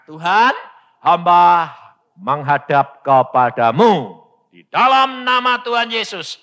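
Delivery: loud, drawn-out formal prayer; retold solemn prayer. Preacher prays in his room like Hizkia, loud and solemn. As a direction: address God grandly, stretching the words. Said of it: "persis seperti Hizkia".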